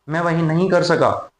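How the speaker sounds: normal talking voice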